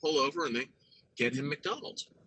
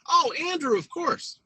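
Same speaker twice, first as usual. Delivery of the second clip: mock-sweet gushing praise; doting parents. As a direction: coo it like a doting mother.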